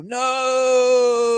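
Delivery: a high, loud cry of "No!". Acted. Naomi falling through the portal cries out, a high loud cry. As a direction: cry out as you fall away.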